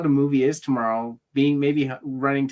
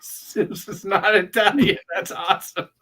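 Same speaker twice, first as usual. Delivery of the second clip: higher, slow, laughing repeat; laughing disbelief; 9 semitones higher, 6 dB louder than usual. Real, not acted. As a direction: savour the line through laughter. Laughing at cohost's remark.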